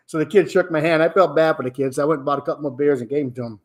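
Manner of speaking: ordinary talk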